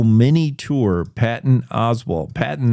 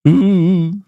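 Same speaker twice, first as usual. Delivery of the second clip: spooky ooh; 7 semitones higher, 6 dB louder than usual. Acted. Spooky cave, bats.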